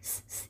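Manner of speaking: "س" as a bare voiceless hiss; snake-like hiss. Shown as a demonstration. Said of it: "اینطوری". («س» مثل هیس مار)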